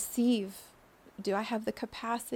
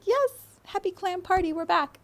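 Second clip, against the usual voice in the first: high, louder cheer; bright mock cheer, much higher; joyful return; 10 semitones higher, 8 dB louder than usual. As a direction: greet old friends with delight. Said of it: "we're like".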